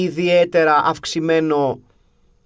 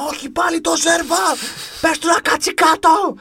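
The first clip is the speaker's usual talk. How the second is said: outraged fan's heckle; 13 semitones higher than usual. Retold or imitated. Fan heckling the bench about a hated player, high indignant yell. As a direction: yell like an exasperated fan at a game. Acted outburst from the stands.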